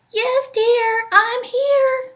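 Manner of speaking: the wolf squeaking sweetly to pass as grandmother; wolf in bed fooling Little Red with a high granny voice; wolf faking grandma. Acted.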